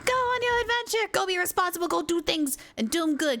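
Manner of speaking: high, rousing send-off that eases down; playful send-off. Pretend. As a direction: cheer them off on an adventure, starting high. Cheering someone off on adventure.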